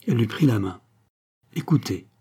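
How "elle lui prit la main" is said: plain reading voice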